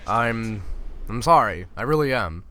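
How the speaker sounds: ordinary talk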